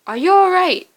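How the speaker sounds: high, loud worried question; urgent concern. Acted. Old friend to the player coming back to the bunker, urgent concern. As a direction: call out in alarm to check on them. Father Hoskins greets returning player.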